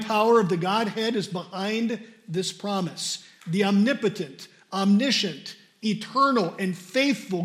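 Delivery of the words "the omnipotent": normal talking voice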